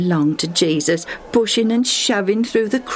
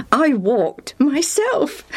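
slow, high line ending in laughter; amused chuckling; 8 semitones higher, slower than usual. Acted. Lucius joking that he walked himself, laughing. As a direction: draw it out, then chuckle.

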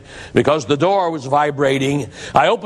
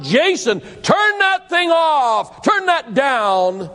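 pitched way up and slow, yelling over the music; retold shout; 9 semitones higher, much slower than usual. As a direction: bellow up the stairs at the son. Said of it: "and I shouted".

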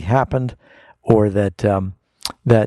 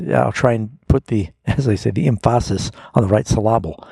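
usual speaking voice

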